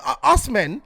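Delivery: usual speaking voice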